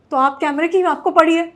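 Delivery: loud, fast exasperated retort to the cameraman; indignant retort. Retold or imitated. Actress snapping at the cameraman about her safety, loud and indignant. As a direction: throw it back at him, fed up.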